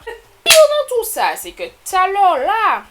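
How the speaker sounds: high, loud outraged cry; shrieking high with outrage; outraged exclamation. Real, not acted. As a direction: burst out in indignation. Outraged outburst about scam.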